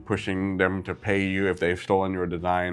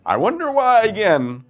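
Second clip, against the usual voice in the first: sarcastic; 10 semitones higher, 5 dB louder than usual. Real, not acted. Sarcasm repeated.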